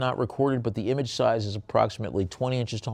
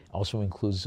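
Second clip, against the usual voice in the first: ordinary talk, nothing marked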